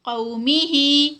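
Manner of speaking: قومی ہی recited slowly with a heavy ق; قومی ہی drawn out slowly in tajweed style; Quranic recitation demo. Shown as a demonstration. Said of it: "قاف ہمارے پاس heavy ہے".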